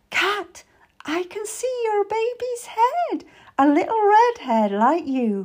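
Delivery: high, delighted announcement; thrilled, high-pitched encouragement; joyful excitement. A narrator's reading. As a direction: share thrilling news with the mother. Meady sees the baby's head coming.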